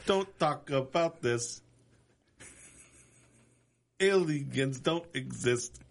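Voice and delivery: alien abduction voice. Pretend. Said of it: "trying to half sing".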